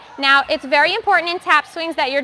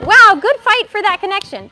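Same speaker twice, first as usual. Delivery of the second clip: excited praise; 7 semitones higher, 8 dB louder than usual. Real, not acted. Coach reacts to a hard skill.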